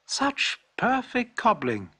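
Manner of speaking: delighted customers praising the shoemaker's work; customers exclaiming. A narrator's reading. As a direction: gush over the shoes. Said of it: "exclaimed the customers".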